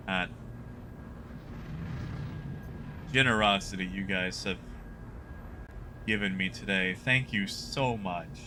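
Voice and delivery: low, quiet, moved thanks; fighting back tears, quietly; choked up. Real, not acted. Choked up by viewer generosity.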